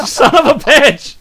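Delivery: loud, high, slow curse; exasperated shout. Real, not acted. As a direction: yell it out exasperated. Reacting to embarrassing picture.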